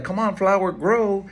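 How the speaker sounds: playful imitation of yelling at a flower; mock yell. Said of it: "I can't yell at a flower".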